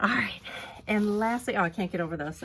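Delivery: ordinary delivery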